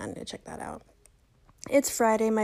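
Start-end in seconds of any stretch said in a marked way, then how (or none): 0.0-0.8 low unvoiced note-to-self; hushed reminder; whispered aside. Real, not acted. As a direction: whisper it to yourself. Aside to herself.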